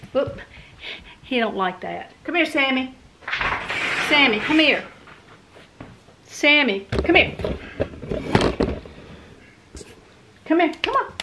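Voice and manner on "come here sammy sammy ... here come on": high sing-song call to the dog. Real, not acted. Owner calling her dog Sammy over, high and coaxing. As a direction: coax the dog over, pitched up.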